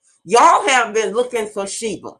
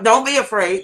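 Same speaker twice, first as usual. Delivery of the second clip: ordinary talk, nothing marked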